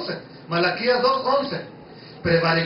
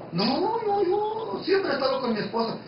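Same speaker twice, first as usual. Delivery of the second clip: a mildly voiced husband objecting, higher and softer; mock protesting husband; 7 semitones higher, 4 dB quieter than usual. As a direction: play the husband insisting he's faithful. (marido que protesta, voz algo más aguda y suave)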